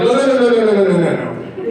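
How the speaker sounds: high, loud, laughing string of nos; panicked refusal. Retold or imitated. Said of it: "I'm like".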